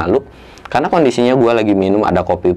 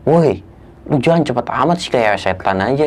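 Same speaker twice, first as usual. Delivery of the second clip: higher-pitched mock call from afar; half-voiced yell, pitched up; mild retold yell; 4 semitones higher than usual. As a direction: imitate a friend calling out in complaint. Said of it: "temen gua dari atas teriak".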